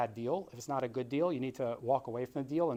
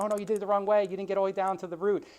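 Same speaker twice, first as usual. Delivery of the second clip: a hint of a correcting expert, higher-pitched; lightly acted chiding, pitched up; [name], nursery expert; 6 semitones higher, 4 dB louder, faster than usual. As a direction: point out the mistake in a raised pitch. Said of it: "you're, like, an expert in nursery".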